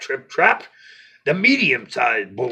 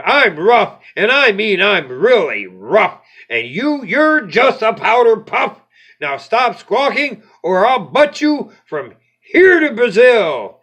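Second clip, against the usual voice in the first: bullying threat; 5 semitones higher, 5 dB louder than usual. Acted. Goat threatens ogre.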